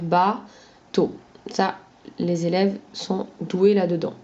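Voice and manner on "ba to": "ba, to" split by a short pause; syllabes séparées. Shown as a demonstration. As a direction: separate "ba, to" into two beats. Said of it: "on va pouvoir les séparer". (« ba, to » séparé par une courte pause)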